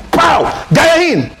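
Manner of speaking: very high shouted command; whip crack shout. Retold or imitated. Trainer whips the lion.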